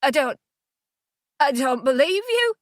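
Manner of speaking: words caught in the throat, forced out high; choked, high-pitched denial; high and scratchy. A narrator's reading. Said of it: "my voice high and scratchy".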